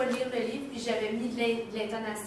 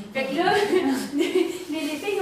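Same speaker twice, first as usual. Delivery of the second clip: laughing; 4 semitones higher, 7 dB louder, slower than usual. Real, not acted.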